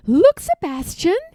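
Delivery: put-on a girl showing her dog France; excited young girl. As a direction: point it out to the dog with a girl's eagerness.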